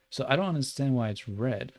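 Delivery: ordinary delivery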